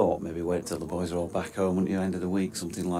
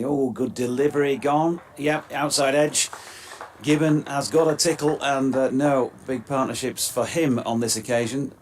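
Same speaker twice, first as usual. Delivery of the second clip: play-by-play lifting higher and louder at the wicket; excited commentary; 4 semitones higher, 4 dB louder than usual. Acted. Commentator calling a wicket for radio listeners, excited play-by-play. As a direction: call the edge and the catch with rising excitement.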